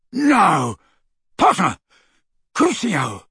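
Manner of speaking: shouted attack. A narrator's reading. Crabbe curses Harry as he lunges, shouting. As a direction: break off and hurl the curse.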